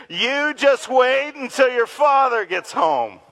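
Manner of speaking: mild mock parental threat. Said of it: "say it with me".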